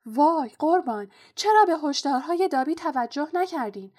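high, loud, pleading house-elf voice; helpless Dobby. A narrator's reading. House-elf reproaching Harry over ignored warnings, helpless pleading. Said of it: "با حالتی درمانده زمزمه کرد".

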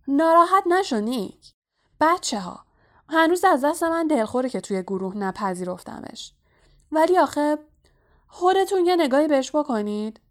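high, loud, teasing call to the crowd; shouting. A narrator's reading. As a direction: holler it out jovially for everyone. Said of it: "از روی زمین فریاد زد". (با فریاد و صدای بلند)

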